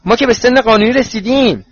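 high, loud youthful protest; protesting together. A narrator's reading. Twin brothers protest being sent out, loud and high. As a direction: object at once, pitched right up. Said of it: "فرد و جورج با هم گفتن".

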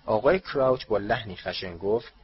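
plain reading voice

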